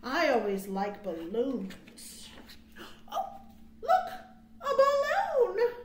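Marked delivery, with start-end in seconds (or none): 3.1-5.7 excited squeal. Acted. Storyteller voicing a character spotting a balloon, squealing excitedly.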